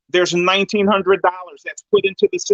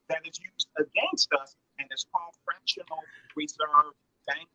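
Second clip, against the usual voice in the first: radio breaking up, words fading; bad line, voice dropping away; voice cutting in and out; 3 semitones lower, 8 dB quieter than usual. Real, not acted. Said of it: "your voice is going in and out".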